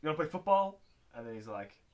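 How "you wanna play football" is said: higher, louder imitated invitation; mock challenge. Host voicing bullies challenging the boy, mock loud call.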